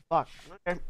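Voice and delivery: usual speaking voice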